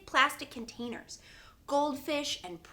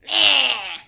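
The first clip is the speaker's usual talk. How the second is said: grumpy meh; 15 dB louder than usual. Retold or imitated. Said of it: "she goes".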